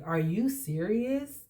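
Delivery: drawn-out, offended disbelief; angry disbelief. Retold or imitated. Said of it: "I was so angry".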